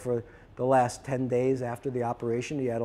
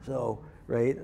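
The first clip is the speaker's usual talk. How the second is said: normal talking voice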